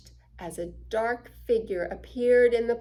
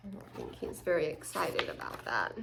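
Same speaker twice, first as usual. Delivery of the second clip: whisper; 6 semitones lower, 10 dB quieter than usual. Real, not acted. Aside to child while reading.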